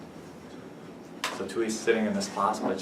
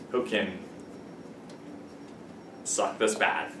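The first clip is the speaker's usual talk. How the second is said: deadpan punchline; 5 semitones lower, 10 dB quieter, slower than usual. Acted. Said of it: "joke punchline undercut".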